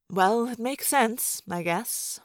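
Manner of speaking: slow, grudging agreement; grumpy. A narrator's reading. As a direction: give in slowly and reluctantly. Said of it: "She looked grumpy".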